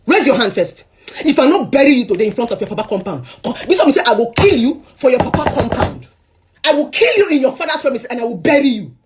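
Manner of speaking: high, forceful threatening; angry threat. Retold or imitated. Wife daring her ex to strike, angry threat.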